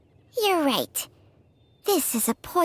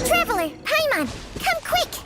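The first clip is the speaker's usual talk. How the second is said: urgent call; 13 semitones higher than usual. Acted. Calling the others to a discovery.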